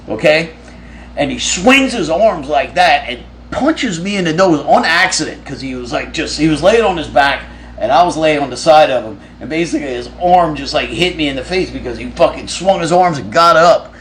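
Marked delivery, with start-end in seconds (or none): none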